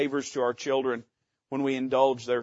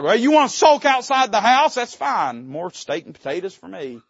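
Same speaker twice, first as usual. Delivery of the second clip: mock petty god; 4 semitones higher, 7 dB louder than usual.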